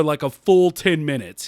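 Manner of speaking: usual speaking voice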